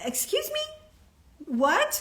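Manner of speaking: mock shock. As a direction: play it scandalized and outraged. Mock offended reaction to prim advice.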